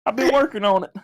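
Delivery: high, near-crying Southern voice; tearful high Southern impression; Camelot. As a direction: mimic a high-pitched Southerner on the verge of tears. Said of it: "You know how he talks".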